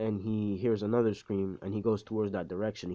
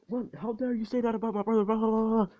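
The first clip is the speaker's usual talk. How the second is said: high-pitched, hurried indignant squawk; hammed-up outrage dissolving into blah-blah; mock outrage; 12 semitones higher, faster than usual. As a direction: rattle it off in a high, affronted voice. Acted outraged reply in a retold quarrel.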